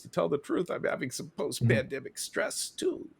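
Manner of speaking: lightly voiced turtle confessing; a hint of a meek turtle; small, hesitant turtle voice; cartoon turtle. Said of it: "and the Turtle says".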